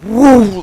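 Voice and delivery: loud, rising car-speeding noise; drawn-out imitated whoosh of a speeding car; whooshing "woo". Storyteller imitates the truck hauling off, loud whoosh.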